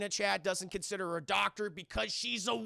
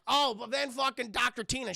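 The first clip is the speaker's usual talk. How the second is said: normal talking voice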